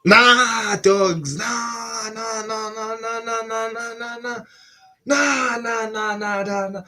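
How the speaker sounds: high, drawn-out string of refusals; real amazed protest held on a high note; stunned disbelief. Real, not acted. Reacting to a Blue Lock clip, high-pitched disbelief.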